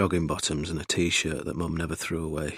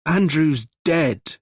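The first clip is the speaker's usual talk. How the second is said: slow, high, loud anguish; slow grief-stricken cry; distraught; 10 semitones higher, 7 dB louder, much slower than usual. Acted. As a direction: drag out the awful news. Gregory breaking the news.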